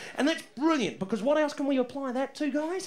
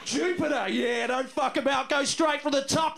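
ordinary talk

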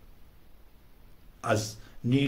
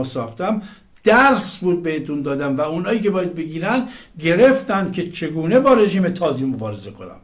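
fired-up rant; 6 semitones higher, 7 dB louder than usual. Real, not acted. Passionate rant to his viewers.

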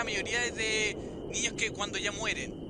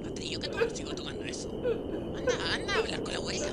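whisper; 8 semitones higher, 3 dB quieter, faster than usual. Acted. Voz interior que lo incita.

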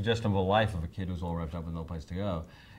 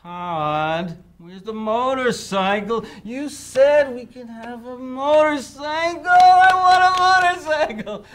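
put-on much higher, slow and louder, a nagging child; whining kid; 11 dB louder, much slower than usual. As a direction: drag the words like a spoiled four-year-old. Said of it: "whining kid going".

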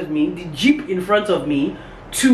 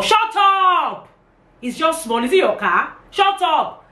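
angry shout; 12 semitones higher, slower than usual. Retold or imitated. Retelling telling street bystanders off, shouting angrily. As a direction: snap them silent, furious.

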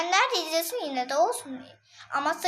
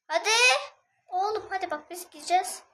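ordinary talk

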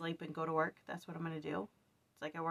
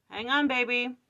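calling out; 4 semitones higher, 8 dB louder than usual. Real, not acted. Calls out to her child.

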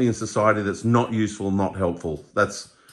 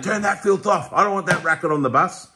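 grumpy bloke quote; 7 semitones higher than usual. Retold or imitated. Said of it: "They wouldn't be saying".

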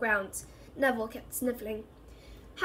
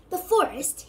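higher, alarmed question; losing his cool; 7 semitones higher, 5 dB louder, slower than usual. A narrator's reading. Boy learns he's going into the forest, alarmed. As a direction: blurt it out, pitch jumping up. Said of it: "didn't sound quite as cool as usual".

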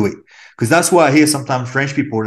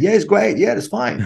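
imitating breezy praise in a higher voice; high-pitched, placating mimicry of well-meaning people; mock reassurance; 9 semitones higher than usual. Quoting people's easy praise.

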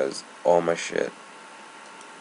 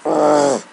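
loud high frustrated ugh; real exasperated groan; 10 semitones higher, 12 dB louder than usual. Real, not acted. Frustrated at missing torches.

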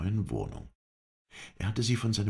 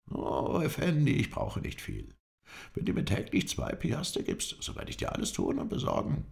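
higher, eager servile caretaker voice; a humble trader offering his services, pitched up; 10 semitones higher than usual. A narrator's reading. Baruch introduces himself to the Effendi, eager and servile.

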